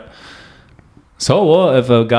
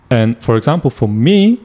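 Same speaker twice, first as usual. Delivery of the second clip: usual speaking voice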